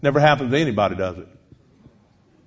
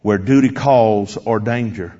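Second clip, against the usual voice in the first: hymn recitation; 3 semitones lower than usual. Retold or imitated. Preacher closing his story with the hymn, slow solemn recitation. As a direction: recite it measuredly, easing down to a close. Recites the hymn's third stanza.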